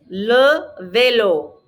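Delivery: LE VÉLO drawn out slowly; teacher modelling LE VÉLO for repeating; LE, slight gap, VÉLO; slow model pronunciation. Shown as a demonstration. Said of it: "Répétez".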